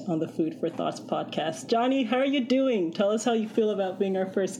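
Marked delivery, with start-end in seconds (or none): none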